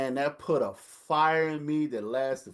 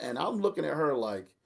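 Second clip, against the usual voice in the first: usual speaking voice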